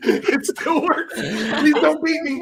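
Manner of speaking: slow, high, laughing plea. Acted. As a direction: beg slowly while cracking up.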